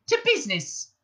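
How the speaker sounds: high, brisk mouse voice; mouse getting to business. Acted. Benji Mouse opens the talks, businesslike mouse voice. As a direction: pipe it up to start the meeting.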